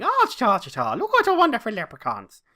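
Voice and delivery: high, loud, hammed-up stage-Irish caricature; mock stage Irish. Mocking American TV's idea of an Irish person, exaggerated stage Irish. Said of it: "an American made a show about an Irish person that was going".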